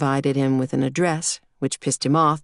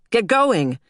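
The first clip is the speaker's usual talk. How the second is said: higher, brisk fatherly command; urgent order; 7 semitones higher, 3 dB louder than usual. A narrator's reading. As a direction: hurry the kid along. Father hurries daughter, clapping.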